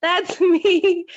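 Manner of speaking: laughing it out, high and slow; embarrassed laugh on the last word. Real, not acted. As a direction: giggle through the admission.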